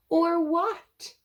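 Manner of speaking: sniffing. Acted. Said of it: "he sniffed".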